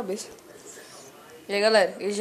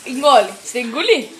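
loud urging; 9 dB louder than usual. Real, not acted. Urging friend to swallow.

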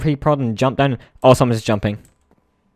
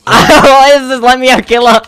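laughing yell; 14 semitones higher, 14 dB louder, slower than usual. Real, not acted. Trapped, laughing and yelling at teammate.

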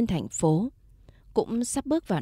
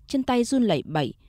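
ordinary delivery, nothing marked

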